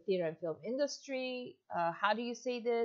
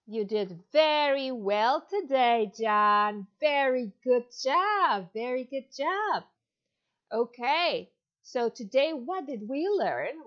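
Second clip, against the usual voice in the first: put-on louder, bright teacher voice for a young child; demo kids' lesson; 4 dB louder than usual. Shown as a demonstration. Teacher to little John in China, upbeat and encouraging. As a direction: cheer on a five-year-old online student. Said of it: "we're gonna go on to our demo lesson".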